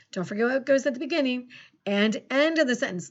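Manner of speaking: normal talking voice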